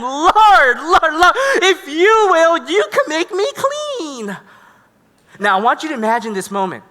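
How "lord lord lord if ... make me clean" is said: high, loud pleading cry, acted; desperate begging. Leper fallen on his face before Jesus, begging. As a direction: beg at the top of your pitch. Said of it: "fell on his face and begged him".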